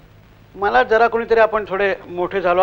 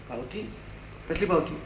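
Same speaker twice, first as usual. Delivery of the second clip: bewildered murmur; 15 dB quieter than usual. Retold or imitated. Bewildered narrator asks back.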